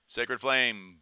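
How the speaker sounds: ordinary talk, nothing marked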